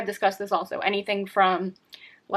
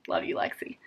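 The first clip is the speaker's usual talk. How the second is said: soft whisper; quick whisper to Lexi; whispered aside; 8 dB quieter than usual. Real, not acted. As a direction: breathe it tenderly off to the side. Aside to dog while reading.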